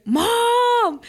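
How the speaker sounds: high, long-held yell for Mom; drawn-out, high-pitched call; retold scream. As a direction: re-enact screaming for your mother. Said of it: "And then I screamed".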